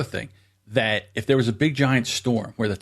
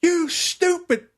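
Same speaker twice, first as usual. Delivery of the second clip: retold yell; much slower than usual. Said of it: "he's yelling".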